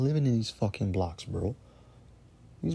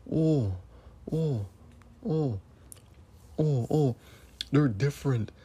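mock whiny voice of the critics, slightly raised. Ranting host mocks critics who defend TV networks, sarcastic mock voice. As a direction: sarcastically parrot their excuse. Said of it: "That's what you're saying".

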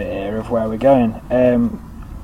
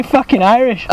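normal talking voice

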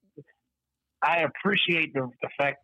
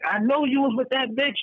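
put-on accusing girlfriend; 9 semitones higher than usual. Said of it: "she's like".

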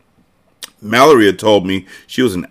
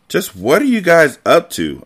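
higher-pitched demanding question; mild scolding; 9 semitones higher than usual. A narrator's reading. Babysitter chasing boys up the stairs, mild scolding. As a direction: lightly call out a suspicious question. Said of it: "I asked as I chased them up a flight of stairs".

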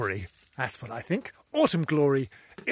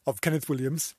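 ordinary delivery

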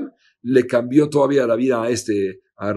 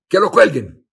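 clipped command, higher and louder; king's stern order; 6 semitones higher, 6 dB louder than usual. Retold or imitated. King condemns the lying priest, angry and loud. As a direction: order the hanging with cold force.

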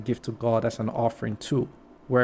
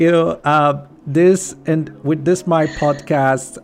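laughing; 4 semitones higher, 6 dB louder, slower than usual. Real, not acted. Still laughing after joke.

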